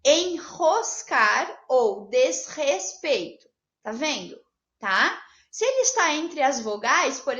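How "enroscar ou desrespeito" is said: slow "enroscar ou desrespeito" with hard guttural R after n and s; strong R after consonant. Shown as a demonstration. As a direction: spell out "enroscar" and "desrespeito" slowly by syllable. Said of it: "primeira letra de uma sílaba que está após uma consoante".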